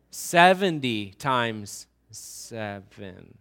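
each word slow and weighty; slow emphatic. Shown as a demonstration. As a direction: land "Seventy times seven" slowly. Quoting Jesus' answer.

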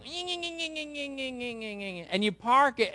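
high, drawn-out nasal engine whine, very slow; puttering little three-cylinder buzz; sputtering engine hum. Retold or imitated. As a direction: mimic a tiny motor straining up the driveway. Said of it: "you come in sounding like".

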